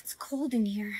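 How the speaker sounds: soft, voiceless aside; whisper. Acted. Girl alone and cold, whispering to herself. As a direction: whisper it to yourself.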